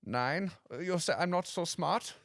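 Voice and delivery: indignant comic German; mock-offended German accent. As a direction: play the affronted German.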